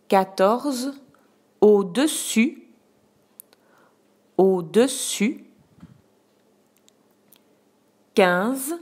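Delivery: slow word-by-word dictation; dictating. Shown as a demonstration. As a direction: dictate each word with long pauses. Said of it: "je vais d'abord te dicter tous les mots".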